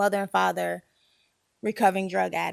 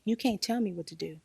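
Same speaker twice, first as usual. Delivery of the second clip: usual speaking voice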